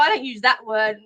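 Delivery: ordinary delivery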